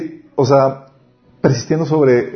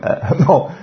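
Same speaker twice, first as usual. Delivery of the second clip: ordinary talk, nothing marked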